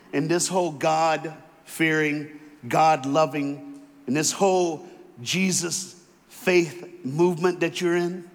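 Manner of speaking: very slow, spelling out each phrase; slow, deliberate dictation. Shown as a demonstration. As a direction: dictate it slowly so they can write. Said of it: "I'm gonna give you a line".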